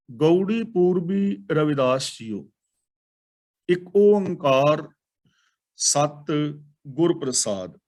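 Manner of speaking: slow, drawn-out reverent intoning; slow solemn Gurbani chant; scripture recitation. Acted. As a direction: intone the sacred opening slowly. Announces shabad heading and Mool Mantar.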